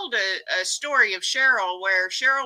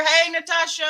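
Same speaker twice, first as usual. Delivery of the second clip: calling out hello; 6 semitones higher than usual. Real, not acted. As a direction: hail a friend who just arrived. Greets arriving viewer, calling out.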